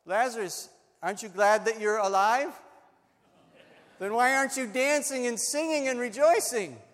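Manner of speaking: loud, rising rhetorical questions; exuberant calling out. Pretend. Preacher calls out to staged Lazarus.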